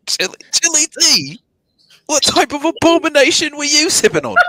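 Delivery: high, mock-outraged British; British accent. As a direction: act appalled in a put-on British accent. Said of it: "have a conversation in British accent".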